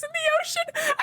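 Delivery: high screaming laugh; shrieking laughter. Real, not acted. Bursts out laughing.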